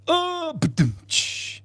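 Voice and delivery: high, voiced drum hit then a hiss; mock rimshot; mouth rimshot. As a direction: do a drum sting for the joke. Rimshot after joke.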